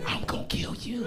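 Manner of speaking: whispered murderous threat; whispered threat from the kale in the blender; menacing whisper. Retold or imitated. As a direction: hiss the threat close to the ear.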